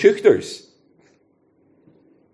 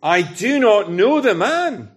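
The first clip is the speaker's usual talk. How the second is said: slow, higher, emphatic denial; clearer denial; 6 semitones higher, slower than usual. Acted. Peter denying Jesus to his accusers, clear and emphatic. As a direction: spell it out firmly, raised pitch, word by word. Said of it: "Peter is starting to be clearer too".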